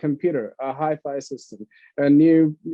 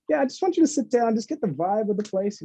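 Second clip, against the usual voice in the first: breezy excitement; 6 semitones higher than usual. Retold or imitated. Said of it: "I'm like".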